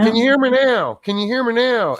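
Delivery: loud, high phone-test voice; Verizon ad guy. Pretend. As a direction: belt it like the phone commercial. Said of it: "Am I in a Verizon commercial yet?".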